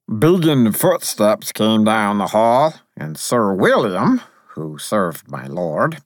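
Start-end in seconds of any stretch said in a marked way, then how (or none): none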